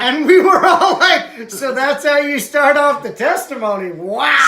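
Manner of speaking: laughing and shocked. Real, not acted. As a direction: giggle through the disbelief. Congregation reacts to swearing.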